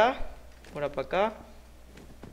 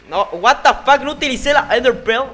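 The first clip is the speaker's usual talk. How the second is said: loud, higher exasperated yell; frustrated outburst; 8 semitones higher, 8 dB louder than usual. Real, not acted. Gamer yelling at his own mistake, loud and higher. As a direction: blurt it out in loud disbelief.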